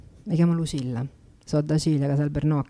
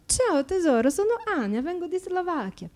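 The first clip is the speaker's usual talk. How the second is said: higher, sweet put-on Slovak accent; pitched-up seductive Slovak accent; 14 semitones higher than usual.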